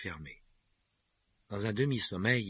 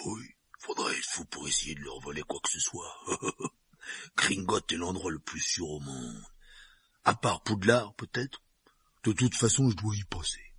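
giant voice. Acted. Hagrid voiced by narrator.

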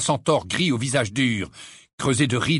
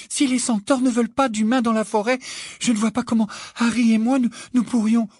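put-on high-pitched, anxious-sounding girl; high voice with a touch of breathlessness; breathless Hermione; 10 semitones higher than usual. A narrator's reading. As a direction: raise the voice high, as if lightly winded. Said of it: "dit Hermione le souffle court".

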